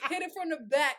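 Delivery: ordinary talk, nothing marked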